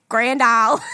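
loud mock Louisiana twang; Southern twang. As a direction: imitate a Southern twang on the town name. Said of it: "I didn't have that, that accent".

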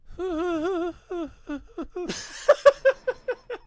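giggly sinister cackle up high; a high creepy clown cackle; evil clown laugh. Pretend. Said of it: "do our own evil clown laugh".

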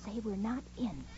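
hushed whisper to a friend. Acted. Roommate hiding from a knock, whispering. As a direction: whisper so the caller can't hear.

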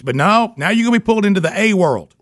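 high, giddy mock lecture with laughs; mock-scold the kid, half laughing; hammed-up rant. Dad ranting at son, laughing.

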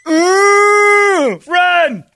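put-on high, loud yelp of a kid; hammed-up scared kid yell; mock kid shout. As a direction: shriek it like a kid fleeing. Kids shouting in retold scene.

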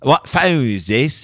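slow, put-on British accent; attempted British accent. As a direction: try a British accent, drawing the question out. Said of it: "trying to do a British accent".

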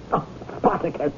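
breathy whispered plea to the whale; soft whisper. Acted. Pleading with Spartacus the whale in a whisper. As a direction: hush it, almost only breath.

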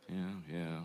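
low, very soft sheepish admission; mumbling. Acted. Customer admits it to the barber, mumbling sheepishly. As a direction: mumble it low, barely owning up. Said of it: "he's just mumbling to himself".

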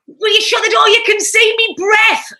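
high, loud re-enacted complaint; screaming. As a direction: shout it in exasperation, drawing out the last words. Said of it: "I used to be screaming".

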